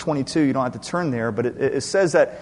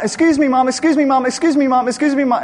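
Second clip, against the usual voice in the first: high, loud nonstop repetition; nagging child; 13 semitones higher, 10 dB louder than usual. Retold or imitated. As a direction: imitate a child pestering Dad without a breath. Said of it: "She says that all the time".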